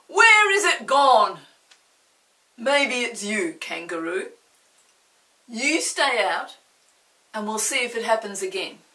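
Mister Archimedes banishes Kangaroo; angry accusation. Acted. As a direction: point the finger and order him out. Angry bather continues accusing.

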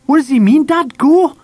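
very high, loud, panicky questioning; alarmed. A narrator's reading. Mam turns pale and asks Dad, panicked. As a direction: appeal to your husband in alarm. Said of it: "gasped Mam".

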